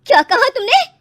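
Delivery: mother-in-law explodes at her bride's taunt; stung fury. Acted. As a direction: erupt at the insult. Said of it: "तिलमिला उठती है".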